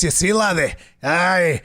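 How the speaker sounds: light Scottish-style Dwarven brogue; Dwarven accent. Retold or imitated. Recalling reading quests on stream, light Dwarven accent. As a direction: roll out a hint of a Dwarven accent. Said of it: "put on a Dwarven accent".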